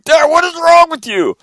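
high, loud indignant yell; outraged kids. Retold or imitated. Kids rolled in snow yell at dad, loud outrage. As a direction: shout it from the snow. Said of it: "they're like".

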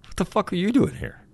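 high, startled outburst of disbelief; shocked disbelief. Retold or imitated. To the Trace Gallagher lookalike, in high startled disbelief. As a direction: blurt it out, stunned to see him.

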